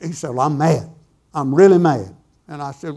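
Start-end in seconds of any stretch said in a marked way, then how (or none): 0.3-2.2 mildly angry retold outburst, louder on the repeat; mad. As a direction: act out an annoyed buddy, building in force. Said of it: "What are you mad about?".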